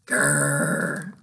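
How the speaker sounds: deep playful dragon growl; little dragon growls at the boat. Acted. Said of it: "little dragon growled".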